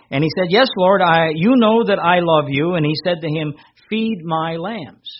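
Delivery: lifted, ringing scripture voice; scripture reading. Acted. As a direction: proclaim the Gospel to the congregation. Continues reading John 21.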